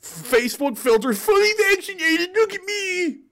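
very high, squealing mock voice; gushing conformist. Showing off the jab in a selfie, squealing mockery. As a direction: squeal it like a preening selfie taker. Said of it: "taking selfies of their, like".

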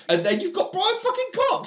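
exasperated, disbelieving punchline; incredulous emphasis. Real, not acted. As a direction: pitch jumps way up on the name. Climax of list of bad casting.

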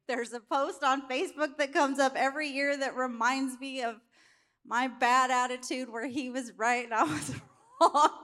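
ordinary delivery

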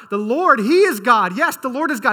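loud, high exclamation of the crowd; crying out. Acted. The people falling face down and crying out, loud high proclamation. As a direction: shout it out as the awestruck people. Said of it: "they cried out".

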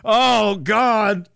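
high, loud mock gasp of shock. Streamer hams up a shocked reaction to chat. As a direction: act out exaggerated disbelief. Mock exclaiming over the island.

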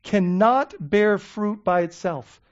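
"cannot" leaned on, each word slowed; slow, deliberate stress on "cannot bear fruit"; slow emphatic reading. Shown as a demonstration. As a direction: make them not miss "cannot". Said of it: "please don't miss that".

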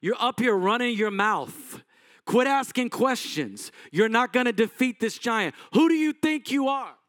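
put-on big brother putting the kid in his place; scolding older brother. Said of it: "David's brother going".

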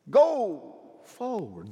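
slow, drawn-out "Go forward" with each word weighted; slow emphatic. Acted. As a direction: ease it down and lean on each word. Repeated command, slowed.

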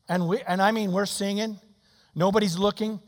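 ordinary delivery

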